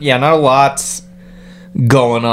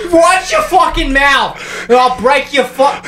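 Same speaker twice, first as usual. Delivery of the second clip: put-on angry New York accent; 11 semitones higher than usual. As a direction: threaten him like a bad movie gangster. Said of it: "That was Boston".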